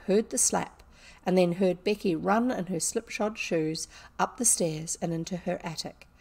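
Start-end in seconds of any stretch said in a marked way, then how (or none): none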